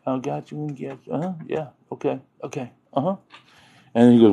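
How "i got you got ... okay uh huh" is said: murmured, barely audible yeses; half-listening mutter; mock distracted mumble; mumbled. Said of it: "he was like".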